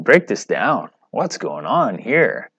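ordinary talk

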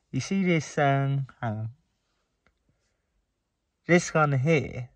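speaking with finger in mouth; finger pulling lip down. Real, not acted. Seen 0: Finger hooks lower lip down to show braces while talking.

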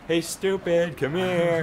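calling the dog. Retold or imitated. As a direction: call the dog from across the yard. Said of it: "we could go like".

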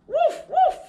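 high, loud playful puppy woof; puppy barking. Acted. Teacher reading to children, barking as the puppy: high loud woofs. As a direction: bark like an excited little dog.

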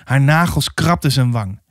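plain reading voice

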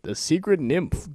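normal talking voice

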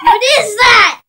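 loud high outcry; shocked shout. Real, not acted. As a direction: yell at a bad taste. Tasting dog food bean.

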